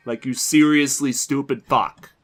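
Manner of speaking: real fed-up fury; pissed-off cursing. Real, not acted. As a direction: stretch out each word in anger. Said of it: "I'm actually pissed off".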